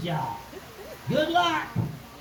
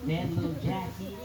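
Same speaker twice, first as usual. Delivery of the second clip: usual speaking voice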